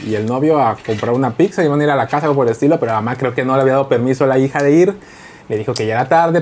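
ordinary delivery

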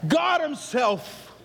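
preacher shouting the story toward Jesus; full-force preaching. Acted. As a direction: ride the climax like a gospel preacher.

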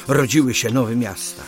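ordinary talk

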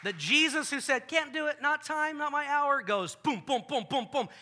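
loud, high, rising to booms; preaching climax. Real, not acted. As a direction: build the miracles to a shout. Climax of the miracle story.

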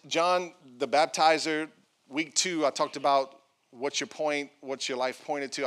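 normal talking voice

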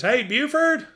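high-pitched call down the stairs; hollering. Retold or imitated. Wife calling downstairs to her husband, high holler. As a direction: holler it high, mildly loud. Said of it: "Beulah Dean hollers downstairs".

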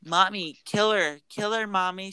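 high-pitched imitation of a pleading child; mock child's plea, pitched well up. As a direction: put on a little high voice, begging Mommy. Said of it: "she does it in, like, a higher voice".

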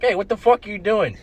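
half-acted yell out the car window, high and loud; retold yell. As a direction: shout it at the kid, mock angry. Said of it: "I was satisfied when I yelled".

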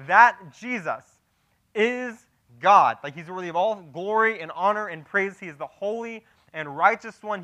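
emphatic, building preacher's indictment; impassioned preaching. Acted. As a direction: hammer each point home to the congregation. Preacher presses Peter's accusation.

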